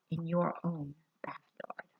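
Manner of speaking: soft secret whisper. A narrator's reading. Secret hiding place.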